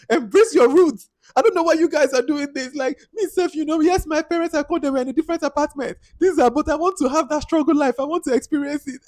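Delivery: high mock pretentious rich-girl voice; imitating Ire. Mocking Ire's speech about wanting the struggle life, high and put-on. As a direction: pitch way up and preen through it.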